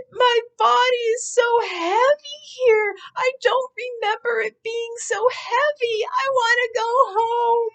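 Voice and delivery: high-pitched, loud wailing sob through the words; crying. Retold or imitated. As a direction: relive the sobbing breakdown, voice cracking high. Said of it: "Just crying and crying".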